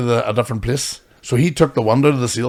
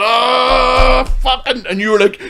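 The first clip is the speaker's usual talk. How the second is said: high, louder hammed-up shout; mock crowd groan and yell; 12 semitones higher, 7 dB louder, slower than usual. Acting out the audience's reaction after the pod, hammed-up yell. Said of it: "the whole audience went".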